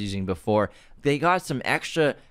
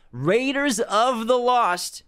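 drawn-out code; 12 semitones higher, much slower than usual. Acted. Stretched coupon code.

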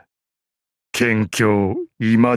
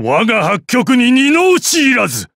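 fierce declaration; 11 semitones higher, 3 dB louder than usual. Acted. Finishing move declaration.

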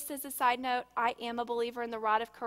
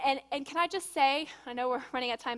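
usual speaking voice

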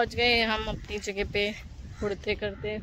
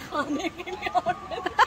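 higher, softer words breaking into laughter; laughing call; 6 semitones higher, 7 dB quieter, slower than usual. Real, not acted. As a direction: try to order but crack up. Calling waiter who ignores them.

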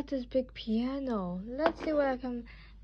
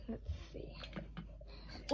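whispering; 15 dB quieter than usual. Real, not acted. Whispering to herself while arranging.

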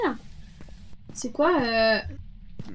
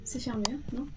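whispering while hiding; 2 semitones lower, 11 dB quieter than usual. Real, not acted. Hiding from the ghost.

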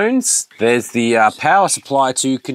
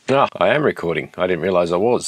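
ordinary talk

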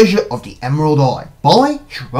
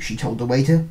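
ordinary delivery

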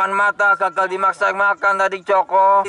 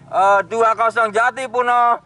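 usual speaking voice

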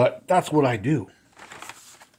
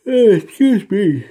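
talking through a real yawn, louder and slower; a yawn breaks into the words; 7 semitones higher, 8 dB louder, slower than usual. Real, not acted. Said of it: "Starting to yawn".

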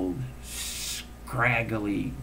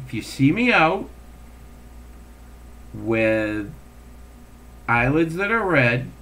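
ordinary talk, nothing marked

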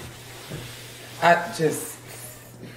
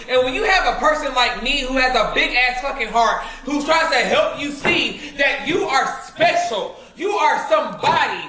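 impassioned rant; 6 semitones higher than usual. Real, not acted.

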